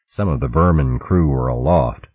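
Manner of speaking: usual speaking voice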